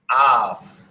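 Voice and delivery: ordinary delivery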